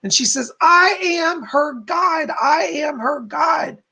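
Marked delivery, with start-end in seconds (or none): 0.6-2.3 high, slow, shouted declaration; relayed screaming spirit. Retold or imitated. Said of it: "this person jumping up and down screaming". 2.3-3.8 high, slow shout with a cry in it; the spirit cries out her claim a second time; screaming, near crying. Retold or imitated. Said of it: "this person jumping up and down screaming".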